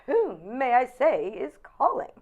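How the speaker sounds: high, loud, stretched-out phone formality; grandly screen the call, drawing it out; mock-formal receptionist. Answering Barbara's phone for her, mock-grand receptionist voice.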